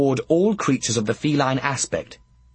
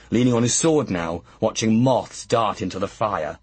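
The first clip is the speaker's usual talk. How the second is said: ordinary delivery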